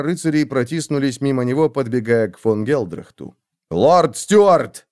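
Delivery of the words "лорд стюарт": loud urgent call. A narrator's reading. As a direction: call out to the lord. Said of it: "позвал фон Вольген".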